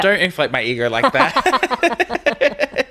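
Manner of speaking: high, drawn-out protest breaking into laughter; amused protest. Real, not acted. Podcast guest playfully fending off flattery, amused protest.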